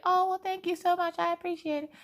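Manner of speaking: hammed-up very high, sweet falsetto; Rachel. Friend meekly thanking her for the gift, exaggerated high impression. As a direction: gush thanks in a tiny mock voice. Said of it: "Now, she don't talk like that".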